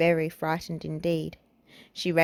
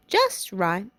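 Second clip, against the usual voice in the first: ordinary delivery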